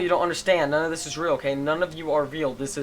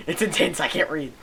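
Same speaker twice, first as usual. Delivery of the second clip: excited; 3 semitones higher, 6 dB louder than usual. Real, not acted. Excited about tense scene.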